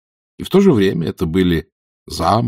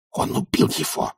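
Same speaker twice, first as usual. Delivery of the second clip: hissed, unvoiced and softer; seething stage hiss; furious hiss; 7 dB quieter than usual. A narrator's reading. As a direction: hiss it under the breath in outrage. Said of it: "шипела она".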